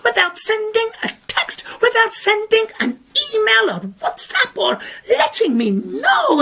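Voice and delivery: high, fussy scolding granny; indignant old lady. Acted. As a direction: tick him off like a prim old lady.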